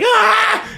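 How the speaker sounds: high, loud startled yelp; mock scream. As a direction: yelp as a truck zooms past. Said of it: "I'm like".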